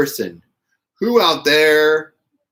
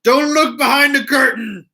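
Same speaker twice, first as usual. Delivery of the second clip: dramatic warning; 10 semitones higher, 4 dB louder than usual. Pretend. Jokey warning about her backdrop.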